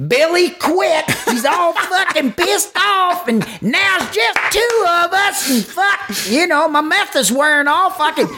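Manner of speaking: very high, laughing mock panic on the phone; waitress calling in, panicked and giggling; frantic waitress. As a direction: squeal it like a frazzled waitress.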